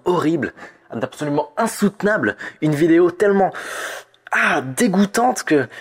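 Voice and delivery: oversell the disgust as a joke; a playful fake shudder at something harmless; mock horror. Mock horror warning about cute video.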